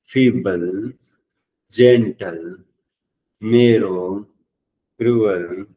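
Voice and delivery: drawn-out words, each lifting at the end; repeat-after-me drill. Shown as a demonstration. Teacher has students repeat adjectives after them, measured rising chant. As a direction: lead a class chant, pausing after each word. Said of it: "वापस मेरे साथ repeat करो".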